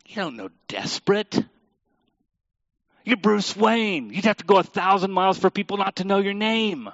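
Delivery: lightly mock the gangster taunting Bruce Wayne; Falcone's little voice. Pastor retelling Falcone taunting Bruce Wayne, lightly mocking. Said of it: "says in his little voice".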